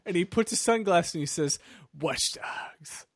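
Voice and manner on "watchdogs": whispered hero's catchphrase; mock dramatic whisper. Hero puts on sunglasses and says it to camera, mock whisper. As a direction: whisper it to camera like a cheesy tagline.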